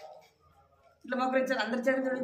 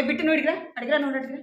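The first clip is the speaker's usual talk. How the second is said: loud, higher telling off; angry scolding; 5 semitones higher, 7 dB louder, much faster than usual. Real, not acted. Teacher scolding a child.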